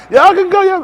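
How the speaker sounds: high, loud laughing send-off; laughing dismissal. Real, not acted. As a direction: laugh them off the stage.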